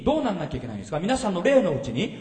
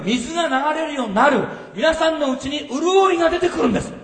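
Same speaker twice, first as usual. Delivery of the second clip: 声を張り上げて高らかに説く; 会衆を鼓舞するように力強く; 熱のこもった説教; 3 semitones higher, 6 dB louder than usual. Acted. Climactic preaching. (会衆に向かって荒れ野の喜びを説く、熱く高らかに)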